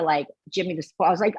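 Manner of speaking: normal talking voice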